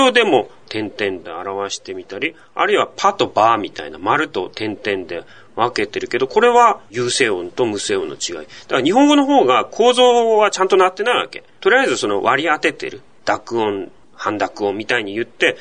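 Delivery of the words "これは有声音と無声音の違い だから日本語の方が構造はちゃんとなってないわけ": normal talking voice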